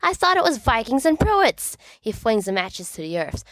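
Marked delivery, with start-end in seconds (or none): 0.0-1.8 distraught. A narrator's reading. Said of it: "distraught betrayal".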